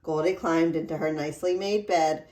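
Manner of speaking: ordinary delivery, nothing marked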